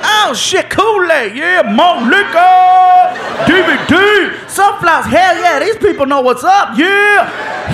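high, mounting glee at each new find; hammed-up gift-opening excitement, stretched cries. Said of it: "He is getting more and more excited".

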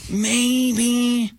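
slow, high, drawn-out mock hope; exaggerated tentative hopefulness; subtle optimism. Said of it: "there's little subtle optimism".

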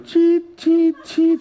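high, sharp electronic chirps; imitated scanner chirp. Pastor imitating the airline check for his congregation, high scanner chirps. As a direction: mimic a checkpoint scanner going off.

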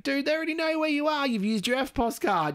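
high-pitched incredulous retort; mock exasperation. Census worker to privacy objector, mock exasperated retort.

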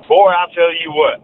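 slow Southern drawl on a local catchphrase; Southern accent, slow and emphatic; Southern saying. Shown as a demonstration. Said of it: "something everyone says down here".

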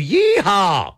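a quick rodeo whoop mid-promo; yeehaw whoop. Acted. Radio host whooping while plugging the show.

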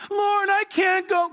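put-on old Moses begging God to send someone else, whining; pleading Moses. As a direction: beg off like a reluctant old man.

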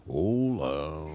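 low, drawn-out greeting; deep parody radio hello; specialist host hola. Shown as a demonstration. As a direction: stretch "Hola" down in an imitation of the host. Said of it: "especializarme en el hola".

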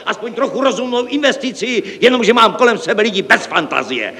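very high-pitched exasperated rant; hysterical yelling. Acted. Director berates unimaginative staff, yelling hysterically. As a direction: rail at them, pitch kept up. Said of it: "křičel".

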